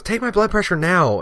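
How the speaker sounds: imitating a pushy, high-voiced demand; bossy order. Older woman insisting to the firefighter, bossy order. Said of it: "just kinda ordering me".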